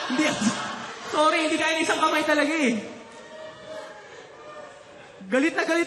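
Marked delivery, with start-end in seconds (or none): 0.1-3.0 frustrated outburst. Retold or imitated. Angry retelling of sock mishap.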